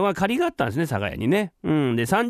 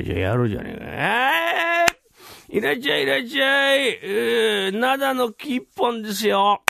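put-on drunk hawker's call; 7 semitones higher than usual. Drunk seller calls out to customers.

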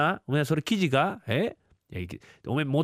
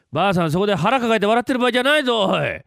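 higher, louder scolding shout; exasperated yell; 7 semitones higher, 6 dB louder than usual. Acted. Uncle shouting at his wife laughing nearby, exasperated. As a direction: snap at her to stop laughing.

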